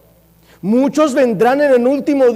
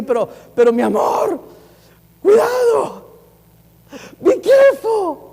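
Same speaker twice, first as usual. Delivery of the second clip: pleading warning call; 9 semitones higher than usual. Retold or imitated. Preacher calling out a warning to the young woman, high and urgent. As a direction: call out a caution, voice jumping up.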